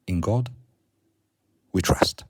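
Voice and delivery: reads the banknote motto out loud and firm. Real, not acted. Reading the dollar motto aloud to viewers, loud. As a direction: declaim each word with weight.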